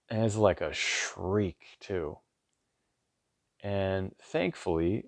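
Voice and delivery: ordinary talk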